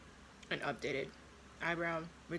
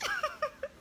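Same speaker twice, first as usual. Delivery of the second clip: high laugh. Real, not acted. Laugh after joke.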